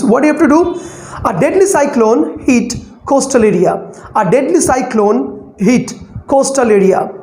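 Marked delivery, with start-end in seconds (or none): 1.3-7.1 pauses after "A deadly cyclone" and after "hit"; scanning with breath breaks. Shown as a demonstration. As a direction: break the line into relaxed chunks: "A deadly cyclone", "hit", "coastal area". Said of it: "we have to take the breath".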